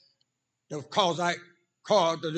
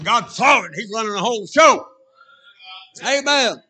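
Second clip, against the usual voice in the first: louder and louder to a high amen; rising preacher's fervor; 5 semitones higher, 8 dB louder than usual. Real, not acted. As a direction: build up to a shouted amen.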